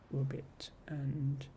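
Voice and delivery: soft unvoiced whisper, tacked on; whispered aside. Real, not acted. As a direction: breathe it out as a shy little add-on. Trailing aside after invitation.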